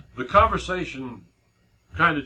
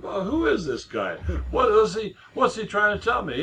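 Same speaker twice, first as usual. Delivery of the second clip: retold disbelief, pitched higher; imitating a doubting man asking who this guy is; incredulous; 8 semitones higher than usual. Skeptical man sizing up the preacher, mock incredulous. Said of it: "he was really incredulous".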